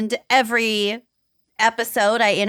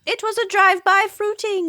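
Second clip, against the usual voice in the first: put-on South African accent; 10 semitones higher than usual. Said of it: "really bad South African accents".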